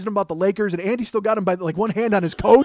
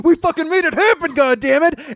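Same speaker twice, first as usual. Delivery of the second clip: high, loud victory cry; triumphant shout; 7 semitones higher, 5 dB louder than usual. Retold or imitated. To brother Andy after breaking through, triumphant yelling. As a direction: crow it over the din of battle. Reenacts triumph amid film battle.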